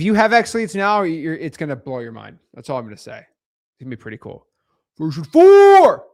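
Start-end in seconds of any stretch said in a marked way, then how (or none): 5.0-6.1 loud, drawn-out hype; hyped announcement. Real, not acted. Streamer teasing the software's next version, hyped announcement. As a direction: belt out the big release, stretching it. Hyping the coming product release.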